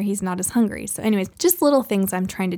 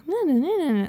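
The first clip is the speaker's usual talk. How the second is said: mock kiddie whine, pitched way up; whiny kids; 7 semitones higher, much faster than usual. Mother imitating kids' voices from YouTube videos, whiny. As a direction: imitate whiny little voices in high nonsense syllables. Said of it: "like, kinda whiny".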